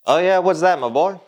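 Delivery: put-on corny old-time boss; 1950s boss talk. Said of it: "Stop talking like you're from the fifties".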